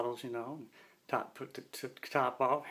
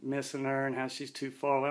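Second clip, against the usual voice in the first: ordinary talk, nothing marked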